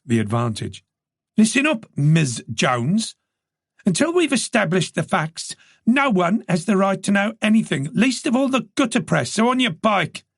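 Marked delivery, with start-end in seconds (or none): 1.4-10.2 firm, higher voice for Charlie; stern female detective. A narrator's reading. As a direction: give the reporter a stern brush-off.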